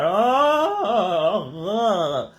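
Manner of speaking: long drawn-out "oh, ugh" groan; nauseated groan. Retold or imitated. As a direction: act out a queasy moan at bright lights. Retold nausea at bright lights.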